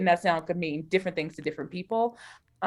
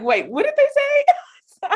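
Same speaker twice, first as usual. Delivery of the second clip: high, slow, stunned "wait, what?"; mock-startled listener suddenly hooked; startled double take; 6 dB louder, much slower than usual. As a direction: snap back to attention in disbelief. Podcast pulls her attention back.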